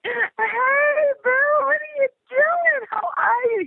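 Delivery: very high, gushing sing-song greeting; gushing hello. Retold or imitated. Greeting her director on the phone in a very high gushing voice. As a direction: gush like greeting an old friend. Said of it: "Not freaking out, but like".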